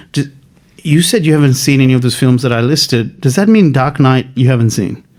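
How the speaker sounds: ordinary delivery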